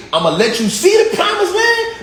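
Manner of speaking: God raising His voice at Moses; God calling out. Retold or imitated.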